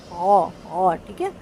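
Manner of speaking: ordinary talk, nothing marked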